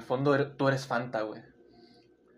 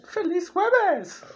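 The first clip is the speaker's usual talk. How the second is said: high, slow, sing-song "¡Feliz jueves!" with a long drawn "jueves"; anime girl voice; much slower than usual. Retold or imitated. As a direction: imitate the voice actress's cute greeting. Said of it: "cómo vergas hizo para hacer la voz así".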